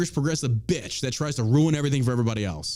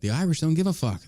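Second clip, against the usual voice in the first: usual speaking voice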